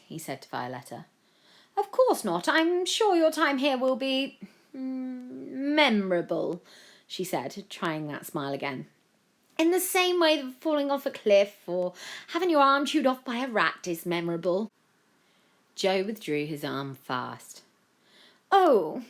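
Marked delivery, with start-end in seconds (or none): 1.8-6.6 high, slow, loaded politeness; falsely sweet. A narrator's reading. Violetta answers Joe with a forced smile, syrupy. Rival's veiled threat. 9.6-14.7 high, loud, relishing the threat; sweetly menacing. A narrator's reading. Violetta threatens Joe while shaking hands, sweetly venomous.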